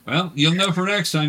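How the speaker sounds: normal talking voice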